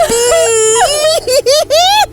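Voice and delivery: shrieky, very high crying; long, high sobbing cry; high wailing sob. Acted. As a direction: break into a ghostly wail. Ghost breaks down over baby.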